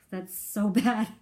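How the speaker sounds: laughing nervously; uneasy little laugh. Real, not acted. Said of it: "that nervous laughter".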